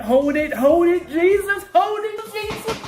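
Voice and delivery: climbing to a squeal as the trade wins; rising excited cry. Real, not acted. Trader begging the candle to hold, building to a shriek. Trade hitting, she cries out.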